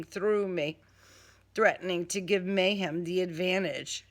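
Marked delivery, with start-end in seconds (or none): none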